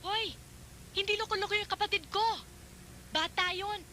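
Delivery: angry retort. Acted. Sister angrily defends her brother.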